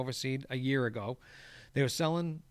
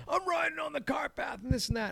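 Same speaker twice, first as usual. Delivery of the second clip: much higher, mimicked whiny protest; mock the other man's excuse, pitched way up; mocking the dad; 13 semitones higher than usual. Dad arguing back at the groundskeeper, mocking imitation.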